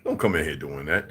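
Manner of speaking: ordinary delivery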